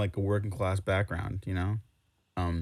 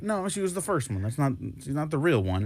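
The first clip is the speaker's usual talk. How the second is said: mocking pedantic fan; 6 semitones higher than usual. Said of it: "It's like".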